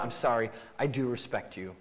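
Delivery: soft, low, sincere sorry; tender apology. Retold or imitated. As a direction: gently make peace with your spouse. Spouse apologizing to break cycle.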